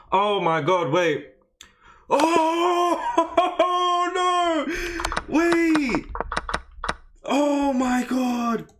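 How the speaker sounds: high, loud shriek of dismay; horrified outburst. Real, not acted. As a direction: cry out in shock, climbing to a piercing wail. Blunders, loses a winning game.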